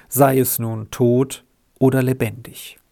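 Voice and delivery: usual speaking voice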